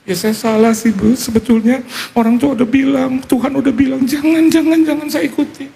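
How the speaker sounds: very high, wailing mock confession; imitated regretful wife. Wife admits ignoring her parents' warning, high plaintive imitation. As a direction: mimic a distressed woman, pitch climbing as she pleads.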